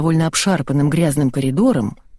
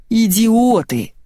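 drawn-out, higher cry of fury; raging director; 5 semitones higher, much slower than usual. A narrator's reading. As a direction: stretch the insult in exasperation. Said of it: "бесновался невидимый дядька".

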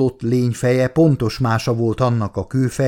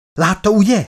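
high, loud startled outcry; exclaiming; 10 semitones higher, 5 dB louder than usual. A narrator's reading. Doctor cries out to her colleague on seeing a twitch, exclaiming. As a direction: cry out with pitch leaping up. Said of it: "kiáltott fel Elizabeth".

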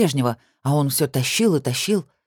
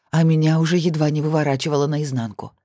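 ordinary delivery, nothing marked

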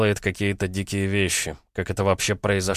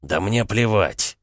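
ordinary delivery, nothing marked

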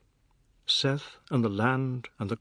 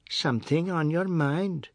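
mocking mimicry; 5 semitones higher than usual. A narrator's reading. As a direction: parrot her words back, higher and sneering. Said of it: "he mimicked".

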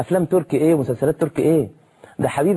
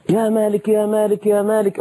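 pleading call; 8 semitones higher than usual. Acted. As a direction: cry out the name desperately, again and again. Crying out to the keeper.

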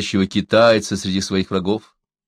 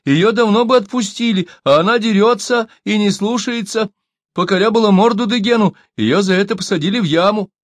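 high-pitched, insistent self-justification; storyteller voicing a squealing captive; 11 semitones higher, 4 dB louder than usual. A narrator's reading. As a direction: keep squealing excuses up high. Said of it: "заверещал он".

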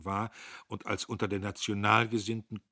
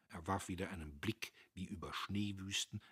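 ordinary delivery, nothing marked

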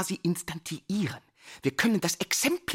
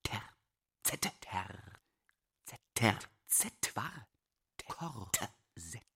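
fragmented murmur; 9 semitones lower, 12 dB quieter, slower than usual. Acted. Word falls apart into syllables.

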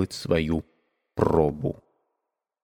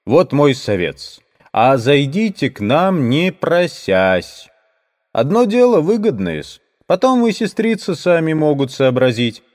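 coaxing tradesman; 6 semitones higher, 5 dB louder than usual. Acted.

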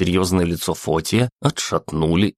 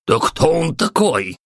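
high, sharp irritated outburst; irritated exclamation; 9 semitones higher than usual. A narrator's reading. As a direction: snap it out in annoyance. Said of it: "раздражённо воскликнул Папа Римский".

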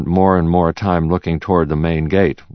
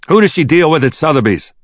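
high, loud, agitated question; urgent demand; 10 semitones higher, 5 dB louder than usual. A narrator's reading. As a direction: demand the contact right now. Agitated boss demanding a contact.

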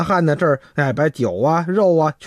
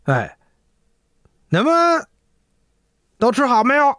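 ordinary delivery, nothing marked

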